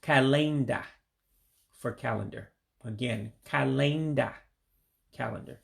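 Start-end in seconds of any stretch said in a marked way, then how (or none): 0.0-0.8 "kalenda" with E sounded as AY, kah-LAYN-dah; hard A. Shown as a demonstration. Teacher explains the E, hard A in the middle. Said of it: "like a little hard A in there". 3.4-4.3 "kalenda" as kah-LAYN-dah, long middle vowel; hard A. Shown as a demonstration. Teacher recaps word for learners, hard A sound. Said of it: "saying it like a little hard A".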